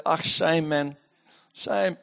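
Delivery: soft, low token sympathy; mock South African pity. South African onlooker offering idle pity, soft mock sympathy. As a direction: murmur a half-hearted commiseration. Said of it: "As the South Africans would say".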